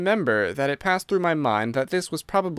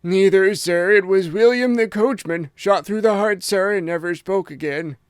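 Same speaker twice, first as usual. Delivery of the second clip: shaken butler; 6 semitones higher, 4 dB louder than usual. A narrator's reading. Butler reporting the killing, shaken.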